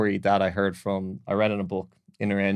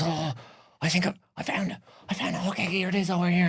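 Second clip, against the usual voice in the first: high-pitched servant voice; acted-out "found it", pitched way up; servant's sudden discovery; 11 semitones higher, faster than usual. Third servant pretends to find toilet.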